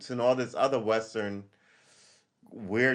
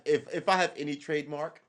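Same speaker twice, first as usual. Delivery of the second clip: usual speaking voice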